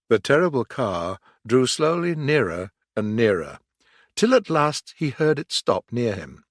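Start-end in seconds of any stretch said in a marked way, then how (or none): none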